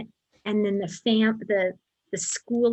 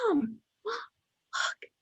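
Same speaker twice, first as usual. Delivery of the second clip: much higher, soft and slow, breathless with wonder; hushed awe; 10 semitones higher, 5 dB quieter, much slower than usual. Retold or imitated. Mother retelling how her daughter spotted the pyramids, hushed awe. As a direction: let it come out as a hushed, trembling gasp. It's hard to talk about without crying.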